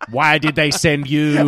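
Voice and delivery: lightly voiced alien, exasperated. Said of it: "I'll play the role of aliens".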